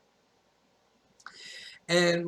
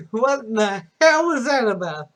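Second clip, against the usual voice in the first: raised, loud imitation of baffled parents; baffled disbelief; 5 semitones higher, 5 dB louder than usual. Parents question son about friend's remark, loudly baffled. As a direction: punch it out in mock bewilderment.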